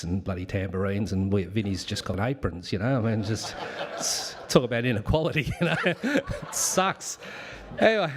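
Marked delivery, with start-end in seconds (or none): 3.0-8.1 1 laughing. Real, not acted. Laughs through aside.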